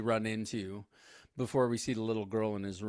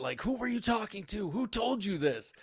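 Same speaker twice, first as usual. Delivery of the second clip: teacher screaming; 8 semitones higher than usual. Retold or imitated. Teacher confronts the boy, mild mock yell up high. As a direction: voice the teacher's rattled outburst, pitched up. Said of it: "he's screaming about it".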